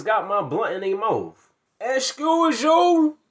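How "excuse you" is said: higher, louder, very slow outraged excuse you; indignant. Retold or imitated. Girls caught smoking his blunt, outraged. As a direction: drag it out in disbelief.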